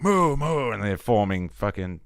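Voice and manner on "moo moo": higher, stirred-up moos; mooing with dawning alarm; awakened cows. Acted. More cows start to wake up, stirred-up mooing. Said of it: "and they're like".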